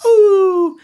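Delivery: sudden high loud ooh; bright sudden rising ooh. Shown as a demonstration. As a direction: shoot the voice up on ooh. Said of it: "if the parents makes a".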